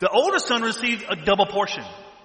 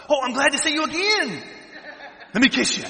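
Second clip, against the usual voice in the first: gushing greeting; 8 semitones higher than usual. Retold or imitated. Preacher acts greeting kissers.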